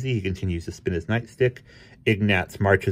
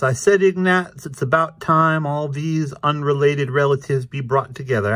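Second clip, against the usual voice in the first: higher-pitched cartoon cat; Krazy Kat; 7 semitones higher, 4 dB louder than usual. A narrator's reading. Krazy explains to Ignatz, higher character voice.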